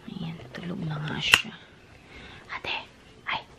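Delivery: soft unvoiced whisper. Real, not acted. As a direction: whisper so she won't wake. Sister asleep, sneaking around.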